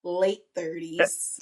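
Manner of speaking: slowly, with a playful jab; slow teasing correction. Real, not acted. Ribbing her cohost about his age, slow and teasing. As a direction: drag out late thirties slowly to tease.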